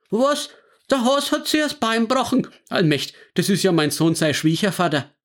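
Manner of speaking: high, startled Franconian grandpa gasping at bad news; stunned grandfather blurting out his shock; shocked old man. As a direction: jolt up in disbelief, then fret.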